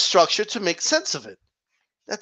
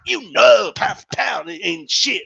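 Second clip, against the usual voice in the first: mock blustering fan; 4 semitones lower, slower than usual. Mocking fan's blustering claim.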